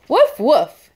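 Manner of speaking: playful barking woof; cheerful puppy woof for kids; dog bark. Pretend. Dog barks in picture book.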